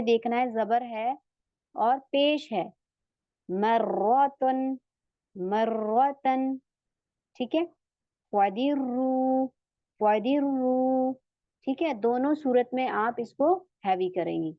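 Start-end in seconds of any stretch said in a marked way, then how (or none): 3.5-6.6 मर्रतन slow, doubled R held heavy; slow heavy R demo. Shown as a demonstration. Teacher recites slowly for students, heavy doubled R. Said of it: "तशदीद हो तो भी आप इसको heavy करेंगी". 8.3-11.1 मर्रतन slow, doubled R held heavy; slow heavy R demo. Shown as a demonstration. Teacher recites slowly for students, heavy doubled R. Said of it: "तशदीद हो तो भी आप इसको हैवी करेंगी".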